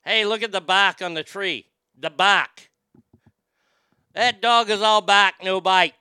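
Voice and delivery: put-on loud Boston accent, dropped R in "bark". Host mocks how Bostonians drop their R, Boston accent. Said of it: "That's how they talk".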